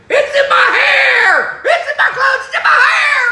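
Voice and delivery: high, wailing mock shriek; screaming like a girl. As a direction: shriek hysterically in disgust, drawn out. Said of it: "screaming like a girl".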